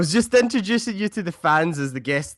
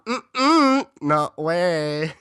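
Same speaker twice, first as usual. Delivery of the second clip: sassy droid; 4 semitones higher, 3 dB louder, much slower than usual. Pretend. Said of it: "I imagine that".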